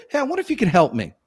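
blurted out very fast; quick and rushed. Shown as a demonstration. Caller opening the cold call quickly, rushed and fast. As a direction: rattle it off in one breath. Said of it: "you could even do this".

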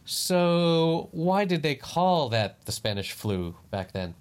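slow, careful, high asking; cautious question. Retold or imitated. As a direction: ask very cautiously, drawn out. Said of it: "I very cautiously".

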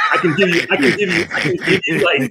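high, laughing false starts; facetious, laughing. Real, not acted. Guest teasing his hosts with a short answer, facetious. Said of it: "I'm being a little facetious".

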